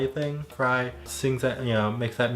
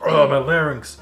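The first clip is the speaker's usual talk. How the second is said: gravelly Lars voice; 3 semitones higher, 7 dB louder than usual. Retold or imitated. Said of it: "He's like".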